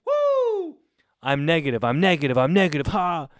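whooping very high, cheering over and over; a roommate cheering his negative test like a firework; wild celebration. Retold or imitated. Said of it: "He was going".

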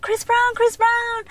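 high-pitched imitation of screaming fans; crazed fan girls. Imitating front-row girls crazy for the singer, high fan chant. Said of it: "all the girls are crazy in the front row".

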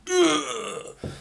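high strained grunt of effort; straining grunt. Retold or imitated. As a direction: grunt like pushing a heavy trolley. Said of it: "trying to push it down the aisle".

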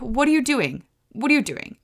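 high-pitched, puzzled questions to a pet; baffled scolding. Retold or imitated. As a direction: question the dog in acted bewilderment. Retold questioning of the dog.

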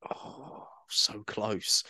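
whispered, defeated "oh, so close"; mock despair as the chat restarts; deflated sigh. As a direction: let the air out, so close to escaping. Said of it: "you're just like".

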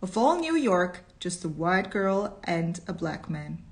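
usual speaking voice

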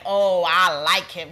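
loud, drawn-out imitation of enthusiasm; mock gushing approval. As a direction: gush it slowly, hypothetically. Father mimics gushing approval.

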